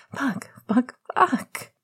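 high, breathy swearing; rising, breathless panic; panting panic. A narrator's reading. Sirius scrambling at tangled sheets, panting panic. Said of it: "he panted, scrambling at the sheets".

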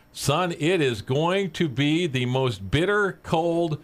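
high, slow, drawn-out forecast; weatherman character. Acted. Joke teller voices the weatherman warning the boy, slow dramatic forecast. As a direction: stretch out each dire word for effect.

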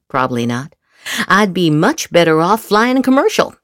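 usual speaking voice

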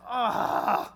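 long exasperated "ugh"; hammed-up groan of disdain; heavy sigh. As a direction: breathe out a loud, disgusted sigh. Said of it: "give the heavy sigh".

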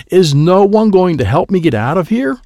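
high frustrated call for help; exasperated shout. Acted. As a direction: call out to anyone who will listen. Frustrated father calls for help.

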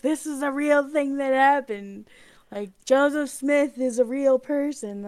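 lightly voiced earnest believers; earnest insistence. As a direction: insist it's all real, lightly. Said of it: "and they're like".

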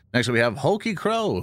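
normal talking voice

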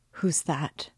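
thick, gasping voice, falling away; a choked, frightened gasp; breathless fright. A narrator's reading. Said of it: "in a thick, gasping voice, in agitation".

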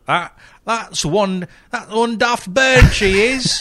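put-on high, slow, overdone Norwegian accent; a clumsy attempt at a Norwegian accent; bad Norwegian accent. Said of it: "I do not know how to do a Norwegian accent".

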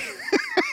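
high squealing laugh; real laugh breaking out; burst of laughter. Real, not acted. Preacher cracks up retelling the choir story, squealing laugh. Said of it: "it was just too funny to me".

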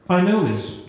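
plain reading voice

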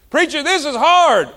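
high, loud mock whine of a church member; pleading "this is hard" to the preacher; whiny complaint. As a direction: play the griping churchgoer.

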